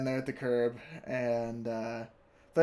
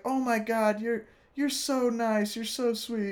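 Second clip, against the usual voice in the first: higher, lightly mocked gushing voice; gushing girl imitation; 10 semitones higher than usual. Bystander girl gushes that he's so nice. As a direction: gush over him, half mocking.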